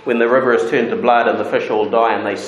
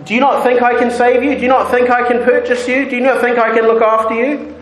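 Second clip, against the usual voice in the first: pleading husband; 11 semitones higher, 3 dB louder, faster than usual. Acted. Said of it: "Hosea might say".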